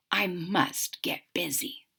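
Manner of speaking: hushed, unvoiced aside; whispered to self. A narrator's reading. Cook whispering a reminder to himself.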